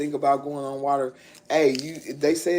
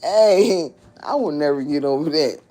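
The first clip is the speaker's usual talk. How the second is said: retold exclamation; 8 dB louder than usual. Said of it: "He was like".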